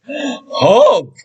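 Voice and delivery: a high, snooty humph; sour humph. Acted. Sour kangaroo scoffing at Horton, high huff. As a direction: huff it out with disdain. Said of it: "humphed the voice".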